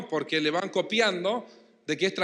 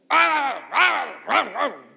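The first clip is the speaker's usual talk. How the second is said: higher, louder mock shouts of an angry parent; imitated yelling; 5 semitones higher, 4 dB louder, much slower than usual. Preacher imitating a parent yelling at the kids, loud mock shouts. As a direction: bark out the yells like a parent who never stops scolding. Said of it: "el excesivo grito".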